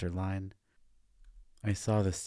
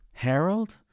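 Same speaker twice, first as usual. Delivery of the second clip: higher and louder call of the name; 11 semitones higher, 7 dB louder than usual. A narrator's reading. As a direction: call his name across the pool. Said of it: "Sharon called out".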